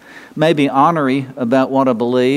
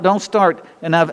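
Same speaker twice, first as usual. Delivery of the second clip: ordinary talk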